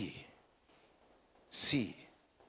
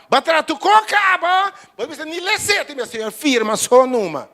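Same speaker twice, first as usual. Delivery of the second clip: loud, high fervent preaching; preaching at full force; 9 semitones higher, 10 dB louder than usual. Acted. Preacher crying out to the church, loud and fervent. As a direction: cry it out to the congregation.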